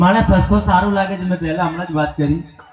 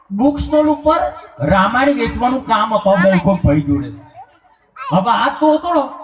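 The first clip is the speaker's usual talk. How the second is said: ordinary talk, nothing marked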